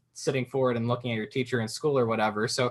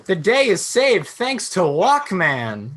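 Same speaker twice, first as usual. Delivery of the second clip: higher, big heroic announcer voice, slowing on the last line; cheesy superhero narrator; 8 semitones higher, 5 dB louder than usual. Pretend. Imagined narrator hails the hero Walkman, in a hammed-up heroic voice. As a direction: ham up a comic-book rescue. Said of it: "can you imagine if there actually was a superhero".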